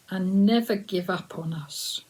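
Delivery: usual speaking voice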